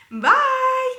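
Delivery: bright squeaky sign-off; cheery high goodbye. Real, not acted. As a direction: sing out the bye.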